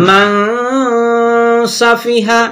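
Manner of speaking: "মান" ends in a long nasal hum before "সাফিহা"; nasal hold. Shown as a demonstration. Teacher demonstrating the nasal reading, n of "মান" sustained. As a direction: hold the n of "মান" in the nose, then release into "সাফিহা". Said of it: "পরে আমরা নাকে আওয়াজ নিয়ে পড়বো".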